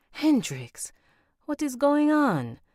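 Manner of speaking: put-on shocked mother with an Italian accent, high; alarmed mother, Italian accent, voice jumping up. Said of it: "my accent is gonna fall Italian".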